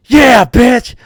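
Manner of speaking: high, loud taunting comeback; gloating mock shout. Referee player admitting he's cheating, loud gloating taunt.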